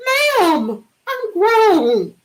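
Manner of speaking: high, pleading protest; indignant protest. Retold or imitated. Daughter answering her mother, high exasperated protest. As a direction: insist you're grown, exasperated. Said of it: "daughter protests".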